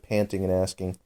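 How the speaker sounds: ordinary delivery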